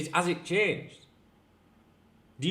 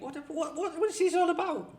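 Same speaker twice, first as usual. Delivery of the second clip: much higher, incredulous what-what; mock exasperation. Slammed online, imitating his own baffled outrage. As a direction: throw up your hands in disbelief.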